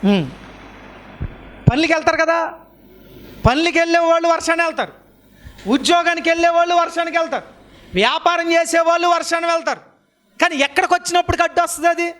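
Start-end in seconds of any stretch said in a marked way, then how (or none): 1.7-12.1 high, sustained preaching pitch; impassioned preaching. Real, not acted. Preacher challenging those who skip church, impassioned. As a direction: drive each point home on a high, repeated cadence. Preacher in raised rhetorical run.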